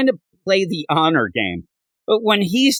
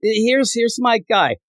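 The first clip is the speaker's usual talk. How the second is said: eager; 7 semitones higher than usual. Retold or imitated. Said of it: "He's like".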